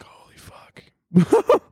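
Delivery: breathless whispered gasp of disbelief; hushed horrified whisper; awed whisper. Acted. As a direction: whisper it, stunned. Acted reaction to crying girl.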